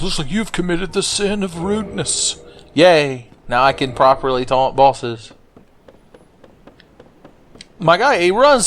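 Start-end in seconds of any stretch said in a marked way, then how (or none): 0.0-2.4 high, soft character voice; higher and softer, imitating a solemn rebuke; mock scolding character. Game character telling the player off for rudeness, mock scolding.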